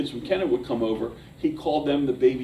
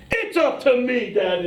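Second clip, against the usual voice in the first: put-on kid's proud call; 7 semitones higher, slower than usual. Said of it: "he said".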